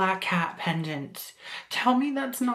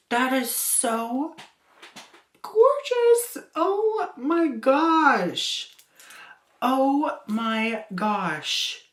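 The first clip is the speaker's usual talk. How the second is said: slow, drawn-out awe leaping high; gushing amazement; 6 semitones higher, 3 dB louder, much slower than usual. Retold or imitated. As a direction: draw out every word in exaggerated amazement. Retells her awed reaction, drawn out.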